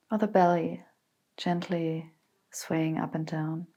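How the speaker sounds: calm, unhurried meditation voice with long pauses; guided meditation. Acted. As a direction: guide them gently into stillness, letting phrases settle. Leads a guided body scan meditation.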